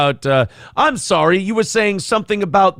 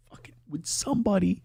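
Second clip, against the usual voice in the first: desperate whispered aside; a lawyer begging the room under his breath; 2 semitones higher, 6 dB quieter than usual. Retold or imitated. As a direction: whisper a plea for help.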